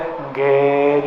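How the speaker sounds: "Engge" drawn out slowly on the last vowel; Javanese yes. Shown as a demonstration. Preacher switching to Javanese for the congregation, drawn-out polite yes. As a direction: stretch "Engge" into a long, slow Javanese yes. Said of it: "saya pakai bahasa Jawa deh".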